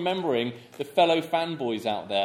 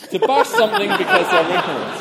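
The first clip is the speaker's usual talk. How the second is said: laughing; 9 dB louder than usual. Real, not acted. Reading the letter, cracking up.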